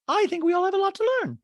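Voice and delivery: high, quick put-on voice; Dorit. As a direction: chirp it in a high, affected voice. Same put-on voice for castmate.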